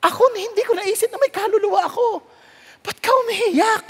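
a hint of a baffled boy; surprised young Moody. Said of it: "Sabi niya". (gulat na batang Moody)

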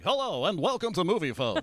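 put-on recorded hotline announcer; big swooping phone-line welcome; Movie Phone announcer. Pranking telemarketers on the phone, announcer voice. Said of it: "I'd answer the phone like".

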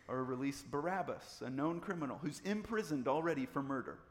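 normal talking voice

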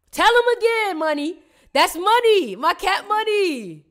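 high, loud and gleeful; loud excited money chant; hyped shout. Real, not acted. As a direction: celebrate the word money.

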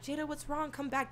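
high, soft imitation of worried callers; imitated concerned friends. Imitates friends calling after her.

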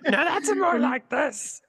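high, slow raspy goofball creature; drawn-out silly alien voice; Jar Jar Binks. Acted. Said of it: "like, Jar Jar Binks".

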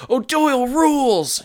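high, triumphant mock cheer; hammed-up victory whoop; imitated gloating. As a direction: crow it like a boastful champion. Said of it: "it was less of a ... to a".

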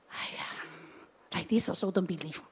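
soft, low sighing reproach; soft exasperation. Acted. Jesus to doubting Peter, not a rebuke, soft sigh. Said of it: "It's more of like".

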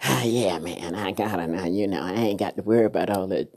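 low, quiet, lazy boast of a man who won her; low quiet mock of a smug man bragging; smug husband. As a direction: mutter like a complacent husband who stopped trying. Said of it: "it's like".

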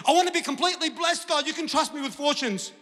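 high, loud hammed-up plea; mock eager declaration. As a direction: play an over-eager believer begging big. Acts out grand wish before deflating.

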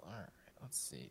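whispered to self; whispering. Real, not acted. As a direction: whisper while counting dice. Muttering to self over dice.

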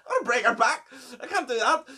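high, laughing panic; panicked laughing. Retold or imitated. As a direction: laugh it out in alarm. Panicked during choking emergency.

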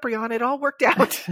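usual speaking voice

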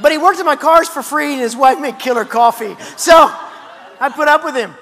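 amused, loud laughing narration. Real, not acted. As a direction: chuckle through the punchline. Still laughing telling story.

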